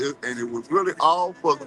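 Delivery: ordinary talk, nothing marked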